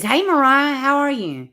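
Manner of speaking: bright, loud hello to a viewer; cheerful greeting. Real, not acted. Streamer greets Mariah in chat, cheerful and loud. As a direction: call out a happy welcome.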